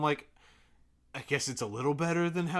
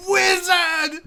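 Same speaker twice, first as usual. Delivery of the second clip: high, loud horrified cry; horrified shriek; 13 dB louder than usual. Retold or imitated. Addie horrified at a wizard in her home. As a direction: shriek it as if it's the worst thing in your home. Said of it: "as if this is the most horrible thing".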